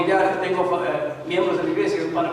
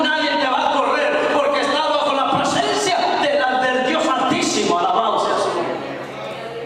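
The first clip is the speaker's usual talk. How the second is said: raised, fervent preaching voice; rally the church to keep praising; 5 semitones higher, 3 dB louder than usual. Acted. Pastor urging the congregation not to fear, fervent preaching.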